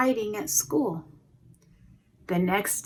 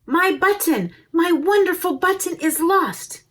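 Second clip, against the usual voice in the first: put-on upset boy; 8 semitones higher, 5 dB louder than usual. Joseph wails over loss.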